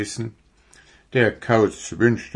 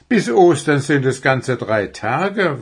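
higher, louder appalled protest; dismayed outburst, pitched up; 5 semitones higher, 5 dB louder than usual. A narrator's reading. Wuschel protests about the Easter deadline, appalled. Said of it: "war Wuschel entsetzt".

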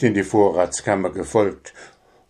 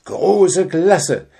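much higher jubilant hedgehog voice; cheering; 7 semitones higher, 3 dB louder than usual. Acted. Hedgehog cheers about Puh's idea, jubilant. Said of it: "jubelte der Igel".

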